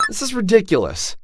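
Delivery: outraged. Acted. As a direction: blurt it out in outrage, pitched high. Outraged reply, pitch jumps high.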